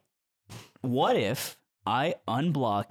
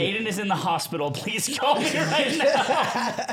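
mock urgent; 8 semitones higher, 4 dB louder, slower than usual. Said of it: "send him a text".

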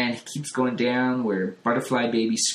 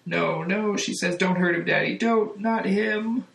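high, pleading cry to her father; terrified plea; 9 semitones higher than usual. A narrator's reading. Jane terrified, begging her monstrous father to spare Cliff, high frightened plea. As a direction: beg Daddy not to hurt him. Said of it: "terrified that Cliff is gonna get chomped".

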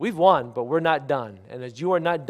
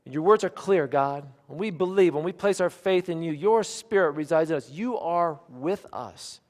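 higher-pitched, earnest public prayer; fervent prayer; 6 semitones higher, 6 dB louder than usual. Real, not acted. Preacher affirming to God that He is with us, fervent prayer. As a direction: lift the voice to God in conviction.

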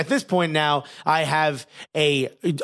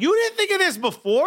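high-pitched outraged disbelief; incredulous; 12 semitones higher than usual. Retold or imitated. Patient to doctor who only now offers numbing, incredulous. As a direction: shoot the question up high, retelling your disbelief. Retold outburst at late numbing.

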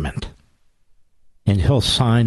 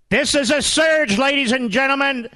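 high, shouted call to rise up; rally speech. Acted. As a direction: fire up the crowd like a rally. Closing call to vote.

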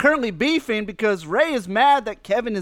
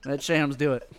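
soft laughing repeat; 9 semitones lower, 8 dB quieter than usual. Real, not acted. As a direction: chuckle the line out quietly.

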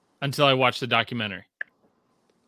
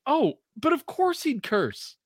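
sarcastic realization; 10 semitones higher than usual. Retold or imitated. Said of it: "to have, like".

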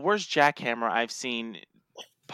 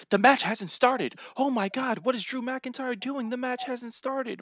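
raised, hammed-up commentator panic; mock panicked announcer; 11 semitones higher than usual. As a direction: parody a frantic announcer, higher.

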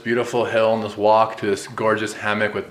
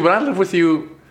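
voice cracking; 9 semitones higher, 4 dB louder than usual. Real, not acted. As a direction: voice cracks on high notes. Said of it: "Did my voice just crack".

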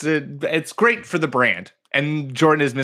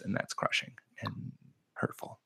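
mock whisper; 4 semitones lower, 16 dB quieter than usual. Mock lament about losing league.

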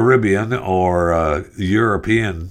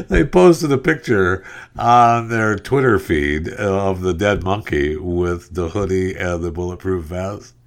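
ordinary talk, nothing marked

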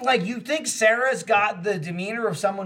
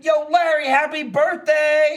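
loud, high, slow birthday call across the room; cheery called-out greeting; 6 semitones higher, 3 dB louder, slower than usual. Retold or imitated. To Larry wearing headphones, a loud drawn-out birthday greeting. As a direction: stretch it out brightly to get his attention.

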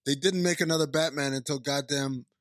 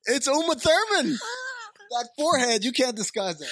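incredulous shout; 11 semitones higher than usual. Real, not acted. Outraged at disguise claim.

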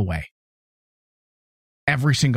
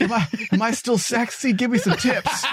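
mock insecure dancer; 9 semitones higher than usual. Imitating himself at a pole.

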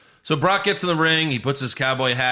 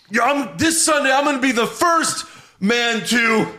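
much higher mock promo voice; mock Lashley promo; 9 semitones higher than usual. Host imitates Lashley's old promo, high hammed-up boast. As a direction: ham up a wrestler's boast. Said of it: "He goes".

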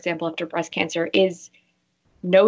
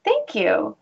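higher, cheeky acceptance; playful self-assured thanks; 7 semitones higher, much slower than usual. Shown as a demonstration. Woman showing how to accept a compliment, playfully. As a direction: accept it with a grin. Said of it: "I'll say something like".

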